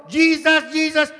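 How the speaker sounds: shouting. Acted. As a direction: scream for rescue as you plummet.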